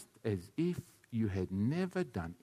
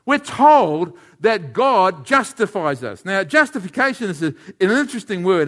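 preaching; 6 semitones higher, 11 dB louder than usual. Acted. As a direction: stretch each phrase to the hall. Opens the Bible passage.

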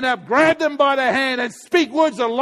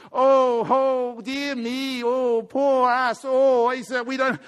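self-pitying whine; 3 semitones higher, much slower than usual. Retold or imitated. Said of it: "you gotta get out of this".

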